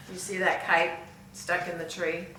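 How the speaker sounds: plain reading voice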